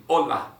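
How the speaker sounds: firm "Hola", h left silent; model hola. Shown as a demonstration. Teacher models "Hola" for students to repeat. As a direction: say "Hola" like "ola", loud and clear. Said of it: "Repeat after me".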